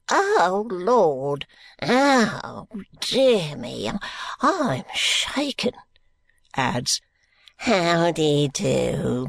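put-on slow, gasping, wheezing old man; a decrepit old miser catching his breath. Grandfather Smallweed greeting George, slow and gasping. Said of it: "the figure in it gasping".

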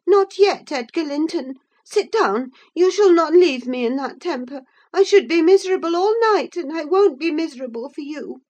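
high, loud, imperious protest; insistent, agitated. A narrator's reading. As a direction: insist hotly, speeding up toward the end. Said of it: "she persisted, grasping the handle".

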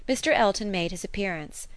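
ordinary delivery

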